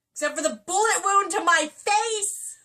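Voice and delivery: loud, high, mock-outraged wail; melodramatic cry. Rhiannon protesting her wound to Carr, hammed-up wail.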